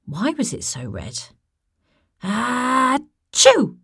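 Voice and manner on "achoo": big loud sneeze. Acted. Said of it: "coughing and sneezing all the time".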